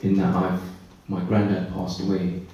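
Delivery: normal talking voice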